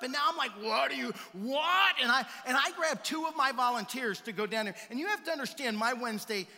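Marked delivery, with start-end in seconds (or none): 0.6-1.9 loud, indignant sputter; outraged what. Retold or imitated. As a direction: splutter in disbelief, loud. Outraged at neighbor.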